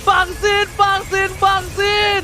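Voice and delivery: hyped live play-by-play call; fighting game announcer. Acted. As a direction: call the teamfight like a hype caster. (komentator esports yang bersemangat)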